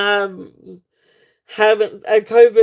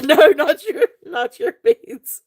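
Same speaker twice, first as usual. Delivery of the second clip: real laughing fit; 8 semitones higher than usual. Real, not acted. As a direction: squeak it out while laughing. Cracking up at a viewer's comment.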